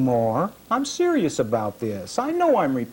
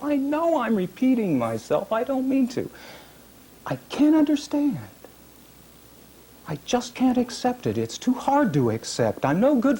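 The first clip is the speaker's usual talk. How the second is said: higher, strained questioning; anguished pleading; 9 semitones higher than usual. Acted. Man who can't understand his own cruelty, higher anguished questions. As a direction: cry out why in disbelief.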